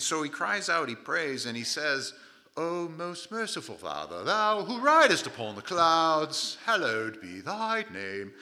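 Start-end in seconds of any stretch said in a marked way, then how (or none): 2.6-8.3 loud, slow, grandiose parody of praying; booming, stretched-out mock-liturgical delivery; mock pompous prayer. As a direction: intone a long-winded prayer with hammed-up grandeur. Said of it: "you don't have time for prayer like that".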